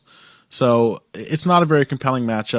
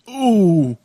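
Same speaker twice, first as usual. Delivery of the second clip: put-on old Yorkshire accent; 7 semitones higher, 5 dB louder than usual. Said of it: "I can say ooh".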